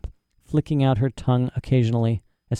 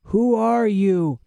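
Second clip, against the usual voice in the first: high, slow, drawn-out challenge; startling voice in darkness; 10 semitones higher, 3 dB louder, much slower than usual. A narrator's reading. Unseen man challenges Lyra in the darkness, startling. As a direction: draw the question out, high and eerie. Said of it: "A man's voice spoke in the darkness".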